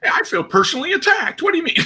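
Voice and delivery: jokingly yelping that he is personally attacked; mock offended. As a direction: play it as hurt in jest, squealing. Joking reaction to a panel jab.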